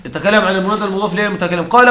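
ordinary delivery

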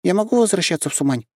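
lightly voiced young girl; high girl voice. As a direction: speak up high like a small girl. Girl voice continues.

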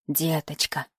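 put-on soft, low old woman's voice; tender old woman. A narrator's reading. Old woman addresses the girl. (тихий низкий голос старушки)

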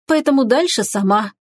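ordinary delivery, nothing marked

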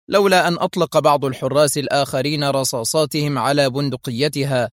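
usual speaking voice

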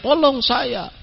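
high, soft mock plea for rescue; hushed, high-pitched imitation of a distant cry; imitated cry for help. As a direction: plead high without raising volume. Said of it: "Mereka teriak".

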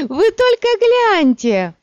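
very high, loud, drawn-out squeal; hilarious outburst. A narrator's reading. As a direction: shriek it slowly in high hilarity. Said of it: "залилась хохотом".

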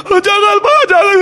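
very high, loud pleading cry; terrified screaming. Retold or imitated. Little sister begs father not to drown her, screaming. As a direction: scream and beg like a terrified child. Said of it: "adik saya teriak".

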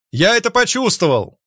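high, loud parting shout; storming off shouting. A narrator's reading. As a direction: call it back over your shoulder. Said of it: "крикнул я на прощание".

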